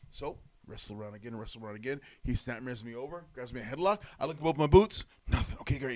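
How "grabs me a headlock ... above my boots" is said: ordinary talk, nothing marked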